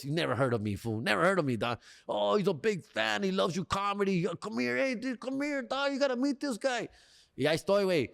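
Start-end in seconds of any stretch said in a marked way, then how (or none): 2.1-6.9 high-pitched, gushing salesman impression; eager, begging salesman. Salesman calling the customer over to meet the boss, eagerly pleading. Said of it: "fucking begged".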